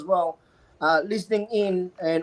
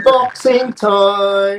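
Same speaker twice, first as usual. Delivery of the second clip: announcer voice; 7 dB louder, much slower than usual. Pretend. Announcing the unboxing.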